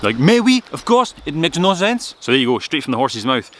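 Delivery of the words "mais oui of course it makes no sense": put-on loud, very high French-accented outburst; Armand's French style. French friend agreeing the name is nonsense, in an excitable French accent. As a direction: exclaim in an exasperated French accent. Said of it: "in his characteristic French style".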